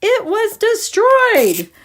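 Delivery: high, slow retelling of her words; slow, high quoted line; quoting her slowly. Retold or imitated. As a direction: lift the pitch way up and stretch it out. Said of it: "And then she said".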